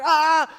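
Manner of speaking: sharp, high, loud cry of pain; retold pained yell. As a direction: yelp as the flame hits your eyes. Fire blows into his eyes.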